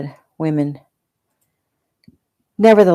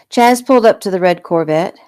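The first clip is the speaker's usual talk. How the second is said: ordinary delivery, nothing marked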